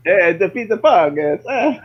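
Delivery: high-pitched cartoon Italian; squeaky-high Italian parody; mock Italian accent. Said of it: "to, like, Italian".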